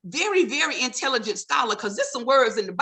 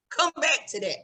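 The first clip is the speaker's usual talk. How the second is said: usual speaking voice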